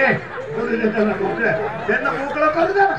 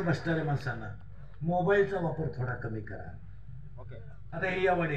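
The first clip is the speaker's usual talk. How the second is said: much softer, lower pleading; soft earnest plea; 8 semitones lower, 12 dB quieter than usual. Real, not acted. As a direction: quietly beg the listeners. (हळू, कळकळीची विनंती)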